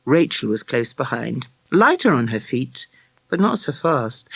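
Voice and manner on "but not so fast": ordinary delivery, nothing marked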